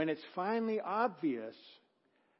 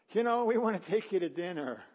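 quoting with a laugh in the voice; laughing while quoting; 5 semitones higher, 8 dB louder than usual. Retold or imitated. Couple to preacher, retold through laughter. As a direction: giggle through their offer. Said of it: "they come up afterwards and go".

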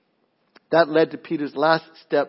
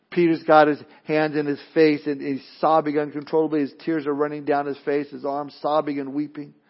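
ordinary talk, nothing marked

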